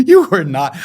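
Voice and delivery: said through a loud, high laugh; laughing disbelief. Retold or imitated. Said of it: "And he starts laughing".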